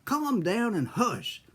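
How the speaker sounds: high-pitched mock scolding from a grandmother; grandmother yelling. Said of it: "My grandmother yelled out there".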